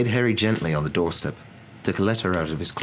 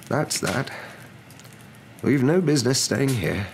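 higher, measured elder's voice; lightly voiced Dumbledore; 7 semitones higher than usual. A narrator's reading. Dumbledore concluding matters.

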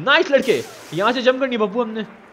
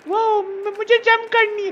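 high squeaky pet-like voice, pitched well up; Pappu voice; 10 semitones higher, slower than usual. Pretend. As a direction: play the little creature whining. Pappu speaks. (Pappu की ऊँची पतली आवाज़)

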